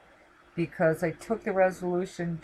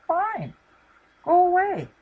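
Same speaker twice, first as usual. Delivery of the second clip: dismissive brush-off; 12 semitones higher, 6 dB louder than usual. Retold or imitated. Brushing off woman who rebuked her.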